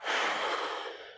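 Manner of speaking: long tired breath out; a real sigh of fatigue; weary sigh. Real, not acted. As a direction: let out a heavy exhausted sigh. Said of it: "this is a man who is tired".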